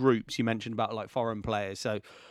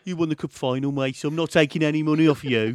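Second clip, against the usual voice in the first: retold ticket inspector in a louder Birmingham accent, a touch higher; 4 semitones higher, 7 dB louder than usual. Ticket inspector refusing a footballer's fare, in a Birmingham accent. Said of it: "Say it in the, say it in the Birmingham accent".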